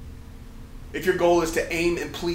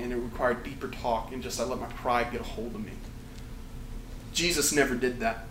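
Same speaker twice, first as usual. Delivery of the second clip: ordinary talk, nothing marked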